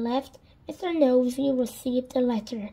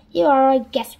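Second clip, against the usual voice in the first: insistent; 9 dB louder than usual. Acted. Twins insist Mr Nosy is guest.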